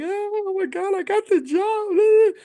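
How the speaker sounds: squealing high, slow "I got the job"; high-pitched giddy squeal; mock excitement. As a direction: gush like you just got hired. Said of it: "We're so excited".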